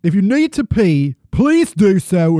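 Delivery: mock-royal British accent, loud and declamatory; hammed-up British royal announcement; attempted British accent. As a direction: read it like a Queen's proclamation in bad British. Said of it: "Let me try to do an accent".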